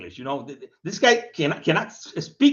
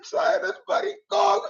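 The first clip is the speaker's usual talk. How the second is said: very high, whiny sobbing voice; mocking the troll as a crybaby; 13 semitones higher than usual. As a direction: whimper it like a bawling child.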